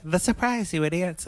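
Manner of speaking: high-pitched retold impatience; acted exasperated reminder; mock exasperation. Retold exasperated reply to clueless guests.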